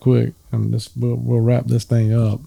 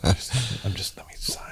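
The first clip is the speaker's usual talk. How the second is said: whispered plea; 4 semitones lower, 12 dB quieter than usual. Retold or imitated. Whispered escape from owner.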